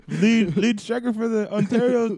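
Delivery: hammed-up, loud indignation; indignant sketch character. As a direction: challenge them loudly in mock outrage. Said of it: "it's like".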